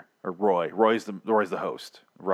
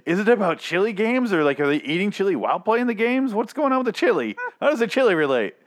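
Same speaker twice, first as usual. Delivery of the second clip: higher, eager rapid questions; excited; 9 semitones higher than usual. Retold or imitated. Recalling excitement at a chili-themed episode, excited. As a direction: bubble over with curiosity. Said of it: "I was like".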